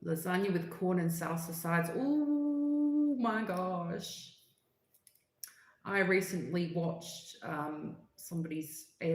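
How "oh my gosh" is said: high, long, stretched-out oh my gosh; drawn-out gasp. Real, not acted. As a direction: melt at the thought of food. Reacting to lasagna craving.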